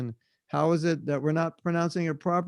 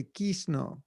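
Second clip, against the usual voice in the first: "Krishna" as KEES-no, Bengali style; Bengali accent; 2 semitones higher, 5 dB quieter than usual. Shown as a demonstration. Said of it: "Bengal, you say Krishna".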